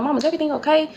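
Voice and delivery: put-on a scared kid asking mom what's wrong; worried child. Phoning mama, hears banging.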